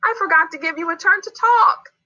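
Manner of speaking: high-pitched little character; childlike, earnest admission; realising a mistake. Acted. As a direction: own up in a small, high voice.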